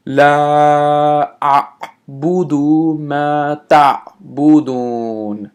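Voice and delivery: slow, chanted recitation; Quran recitation. Acted. Recites second verse.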